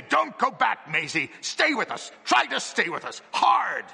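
high, strained, hoarse urging; hoarse urgent plea. A narrator's reading. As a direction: plead hoarsely, building to a shouted last word. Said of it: "He said hoarsely".

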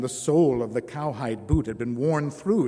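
ordinary delivery

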